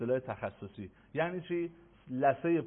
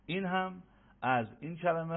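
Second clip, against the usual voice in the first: ordinary delivery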